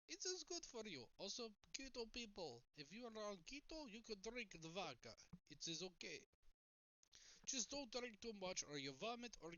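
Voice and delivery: hammed-up Russian accent giving vodka advice; mock Russian accent. Jokingly voicing a Russian to viewers, light Russian accent.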